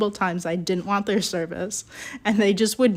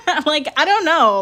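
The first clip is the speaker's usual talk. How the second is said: laughing; 8 semitones higher, 5 dB louder than usual. Real, not acted. Laughing while retelling confusion.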